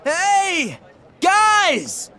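hailing friends from a distance; calling out. Acted. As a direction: shout across to the guys.

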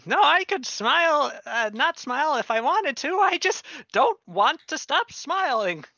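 very high, loud strained guard voice; strained forced smile. Acted. Guard asked if he can stop smiling, strained. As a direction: insist through a smile turned grimace. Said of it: "becoming more of a grimace".

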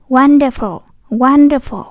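"Wonderful" with WON loud, then falling away; stress on first syllable. Shown as a demonstration. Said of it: "cũng nhấn âm, âm một".

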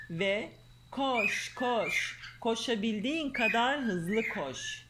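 lively storybook reading voice; reading to children. Acted. Mother reading a fairy tale to her daughter, lively storyteller. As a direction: read the tale aloud to a little girl.

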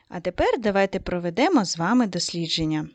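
ordinary talk

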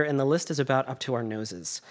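usual speaking voice

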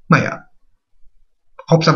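normal talking voice

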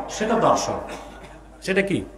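ordinary delivery

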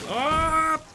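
high, loud burst; effortful yell. Real, not acted. Player making a jump, high yell. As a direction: shout it as you leap.

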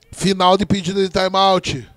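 ordinary talk

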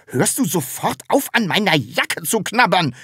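high, alarmed call; startled. A narrator's reading. Taschenbier stopping Sams nibbling his jacket, startled. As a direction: cry out in fright. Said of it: "rief er erschrocken".

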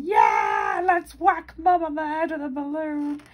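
put-on loud, high kid shout; naughty kid shouting. Kid in the back seat, loud high shout. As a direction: yell it like a rowdy boy. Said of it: "He's going".

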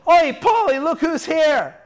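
acted, high call to a mate. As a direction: holler it in a high comic voice. John calls to Paul, laughing.

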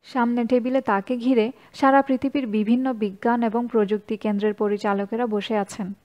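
ordinary delivery

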